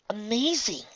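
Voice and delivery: high breathy awe; lightly voiced amazed young woman; amazed girl voice. Twilight voiced.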